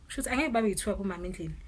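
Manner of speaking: normal talking voice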